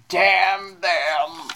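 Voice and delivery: dying gasp. Acted. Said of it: "and he goes".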